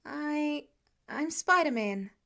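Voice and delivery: put-on nervous kid blurting his name; a hint of a jittery teenager; nervous teen. A narrator's reading. Nervous teen hero introduces himself.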